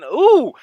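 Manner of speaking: high, loud rising ooh; a thrilled gasp at the spin move; excited ooh. Real, not acted. Gamer reacting to a touchdown run, excited ooh.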